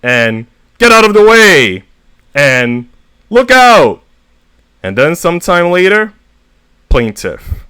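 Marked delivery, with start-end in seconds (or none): 0.8-1.8 loud, much higher shout; barked out by a stranger; angry shout. Retold or imitated. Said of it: "I've been called many things like". 3.3-4.0 loud, very high alarmed shout; yelled at the last second; warning yell. Retold or imitated. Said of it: "I've been called many things like".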